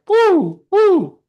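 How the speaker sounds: high, loud little whoops; a quick burst of cheering; mock cheer. Describing fleeting highs of life, whooping cheer.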